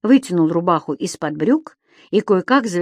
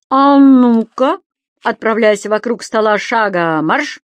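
А ну-ка протяжно и громко, дальше приказ; командирский окрик, растянутый и выше; громкая команда; 3 semitones higher, 5 dB louder than usual. Acted. Дауме командует Субастиком. (Дауме гоняет Субастика вокруг стола, громкий окрик)

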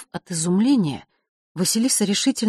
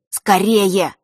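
louder, pressing cry; urgent shout; 3 semitones higher, 8 dB louder than usual. A narrator's reading. Брат торопит сестру, громко и настойчиво. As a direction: hurry her up now. Urging her to hurry.

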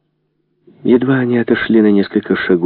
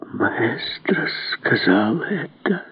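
slow, hushed, higher-pitched disbelief; trembling question; 4 semitones higher, 8 dB quieter, much slower than usual. A narrator's reading. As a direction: ask it slowly and softly through trembling lips. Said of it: "спросила Бенсон дрожащими губами". (тихо, дрожащими губами)

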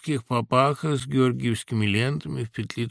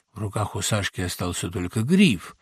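ordinary delivery

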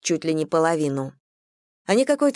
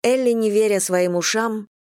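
usual speaking voice

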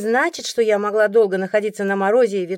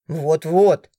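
lightly voiced grumbling man, lower; a hint of a lower male grumble; indignant man; 4 semitones lower, 4 dB louder than usual. A narrator's reading. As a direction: chime in with gruff indignation. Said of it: "это возмущался уже мужчина".

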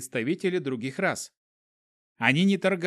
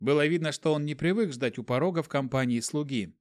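plain reading voice

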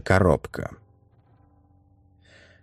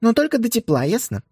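stern mother; faster than usual. Acted. Mother sets a condition.

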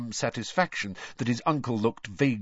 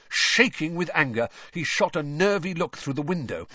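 plain reading voice

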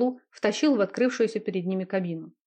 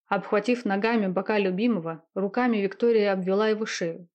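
plain reading voice

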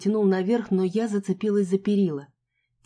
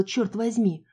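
usual speaking voice

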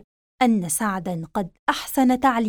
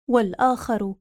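ordinary delivery, nothing marked

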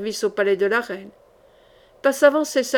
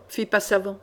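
ordinary delivery, nothing marked

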